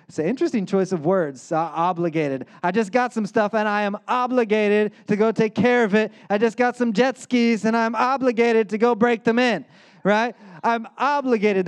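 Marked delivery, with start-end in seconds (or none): 2.6-11.7 raised, louder whiny excuse; mock excuse-maker.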